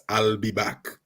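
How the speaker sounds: a mild imitation of Arnold's famous line; Schwarzenegger's own way. An actor quoting his famous film line, lightly Arnold-style. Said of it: "the reason why that was famous".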